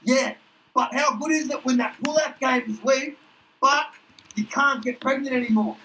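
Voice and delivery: high, gleeful crude bloke; Duck's voice. Acted. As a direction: pitch it up and gloat. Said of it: "he's like".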